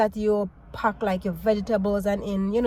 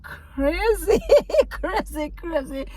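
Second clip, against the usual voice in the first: laughing disbelief; 8 semitones higher, slower than usual. Real, not acted.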